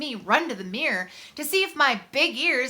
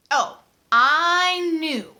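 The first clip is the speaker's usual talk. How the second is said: slow, drawn-out "Oh, I knew" with a louder lift; knowing; 3 semitones higher, 5 dB louder, much slower than usual. Acted. Boy telling us he saw through the hugs, knowing. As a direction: let it sink in, knowingly slow. Narrator recalling the hug lie.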